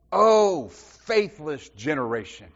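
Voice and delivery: a loud exasperated "Oh", then "faithless generation" drawn out slowly and falling; exasperated quote. Retold or imitated. Jesus scolding the faithless crowd, exasperated. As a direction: throw up your hands at "Oh", sigh out the rest. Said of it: "It is a statement of exasperation".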